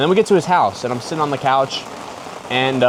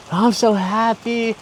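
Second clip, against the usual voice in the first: dreamy blissed-out; 9 semitones higher, much slower than usual. Retold or imitated. Retelling euphoric shroom high.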